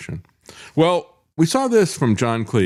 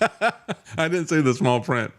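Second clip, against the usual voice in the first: laughing; 7 semitones higher, slower than usual. Real, not acted. Laughing at the turtle-like description.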